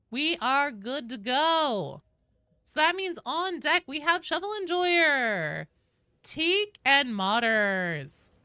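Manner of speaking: loud, drawn-out announcer call. Real, not acted. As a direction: call the names out big and slow. Announcing next players in queue.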